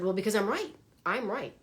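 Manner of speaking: insistent ego. Retold or imitated. Quoting her own ego insisting.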